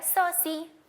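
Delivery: "स, सी" with the letter's s sound. Shown as a demonstration. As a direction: sound out "स" then name "सी". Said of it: "सी की letter sound क्या है".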